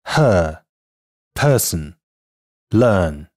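British non-rhotic her, person, learn; British English word demo: her, person, learn; British pronunciation. Shown as a demonstration. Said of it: "英音".

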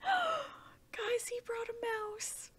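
gasp, then a hushed whisper; delighted whisper. Real, not acted. Player telling viewers about the pet's mouse, excited whisper. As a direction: whisper it high and breathy, thrilled.

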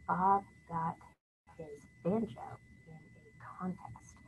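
slow, near-whispered word count; hushed counting. Shown as a demonstration. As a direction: fade to a whisper, one word at a time. Counting words while tapping.

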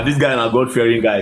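normal talking voice

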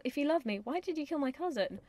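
indignant acted reproach; snotty, arrogant Juliet. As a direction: demand an answer like a spoiled brat. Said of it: "she's snotty and arrogant to me".

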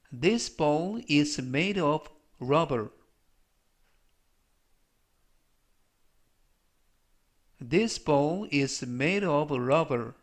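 slow, measured English for learners; slow model reading. Shown as a demonstration. Teacher reading an English example for learners, slow model reading. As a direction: read it out slowly as a pronunciation model.

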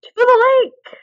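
high, loud rallying cry; leading friends off toward the lake, excited shout; excited call. Acted. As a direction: call the group onward to the lake with joy.

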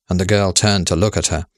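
plain reading voice

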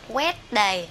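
"Wednesday" misread as "wet-die"; mispronunciation. Shown as a demonstration. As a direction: say "Wednesday" wrongly, without the n. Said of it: "đều sai các bạn nha". (đọc sai "Wednesday" thành "oét-đai")

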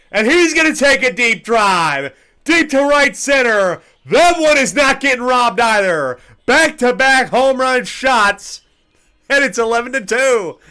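high, loud home run call. Acted. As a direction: belt out back-to-back homers.

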